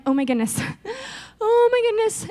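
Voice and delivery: mock panic, breaking into a laugh; panicked young king. Young king walking into the palace, mock panic. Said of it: "being like".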